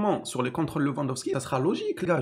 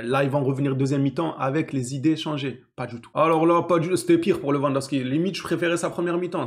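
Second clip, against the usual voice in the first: usual speaking voice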